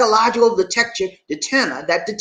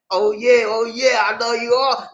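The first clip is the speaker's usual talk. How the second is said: ordinary talk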